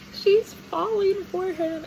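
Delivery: high, drawn-out, loud squeal; slow sing-song gushing; gleeful announcement. Real, not acted. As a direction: stretch it out high with giddy delight. Gleefully announcing the romantic development.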